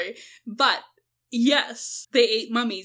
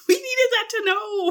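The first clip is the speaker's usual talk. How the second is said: mock lament; 10 semitones higher than usual. Mock outrage over eaten mummies.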